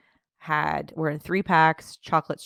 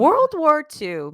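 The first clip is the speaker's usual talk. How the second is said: dramatic; 10 semitones higher, 9 dB louder, much slower than usual. Acted. Dramatic pronouncement about chocolate.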